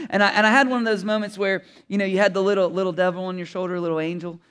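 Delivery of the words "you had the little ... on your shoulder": usual speaking voice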